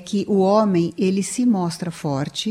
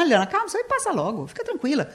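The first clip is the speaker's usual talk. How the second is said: higher, quick, brushing-off mock husband; dismissive husband; 8 semitones higher, 4 dB quieter, much faster than usual. Husband brushing off his sad wife, quick dismissive reply. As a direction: wave her sadness away lightly.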